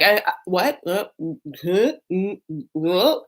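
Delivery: broken, evasive little mumbles and questions; mock stammering. Michael dodging his wife's accusation, mock stammering. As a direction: imitate someone floundering for an answer.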